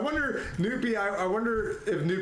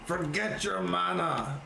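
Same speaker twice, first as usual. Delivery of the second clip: mock paladin; slower than usual. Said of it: "repeats the paladin line".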